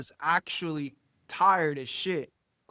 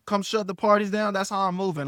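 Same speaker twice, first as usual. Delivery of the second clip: usual speaking voice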